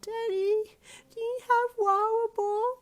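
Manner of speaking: put-on high, slow tired little girl; sleepy toddler asking. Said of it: "very tired little voice".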